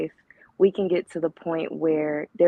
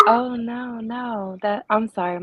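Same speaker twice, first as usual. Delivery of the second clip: light voice; 6 semitones higher than usual. Real, not acted. Caller explains her voice to the host, lightly. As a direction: keep the voice small and light. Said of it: "My voice is just a little light".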